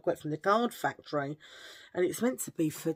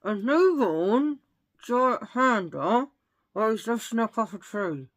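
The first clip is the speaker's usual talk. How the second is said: ventriloquist voice; 3 semitones higher, 5 dB louder than usual. Pretend. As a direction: talk through still lips like a dummy act. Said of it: "try and do this in ventriloquism".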